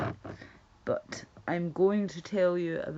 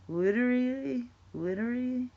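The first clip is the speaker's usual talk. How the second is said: put-on higher, louder girly "Literally"; mock valley girl; 7 semitones higher, 4 dB louder than usual. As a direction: ham up the gushing "Literally". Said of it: "why do lassies say that?".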